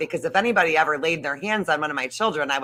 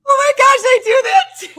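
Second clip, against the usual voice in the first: much higher and louder, squealing recognition; excited squeal; 10 dB louder than usual. Real, not acted. Co-host recognizing herself in the story, excited squeal. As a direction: squeal it like you've been caught too.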